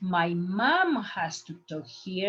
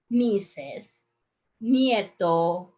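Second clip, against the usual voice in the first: normal talking voice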